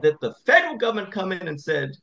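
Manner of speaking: ordinary delivery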